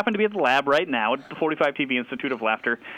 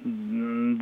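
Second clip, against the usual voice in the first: usual speaking voice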